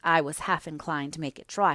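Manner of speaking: ordinary delivery, nothing marked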